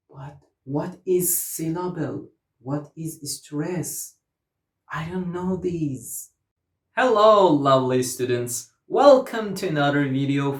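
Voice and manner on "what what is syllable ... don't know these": softer, hesitant learner voice; puzzled student. Acted. Student baffled by syllable and stress, quiet and puzzled. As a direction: play a lost student, quietly confused.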